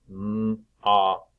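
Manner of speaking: "M-op" with a held m, then op; sounds split apart. Shown as a demonstration. Teacher checking the middle sound, "M-op" sounded out. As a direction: sound out "M-op" in two slow parts. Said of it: "split the sounds apart".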